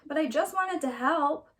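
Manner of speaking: put-on a disappointed little girl letting out a sigh; girl's voice, deflated. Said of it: "Leola sighed".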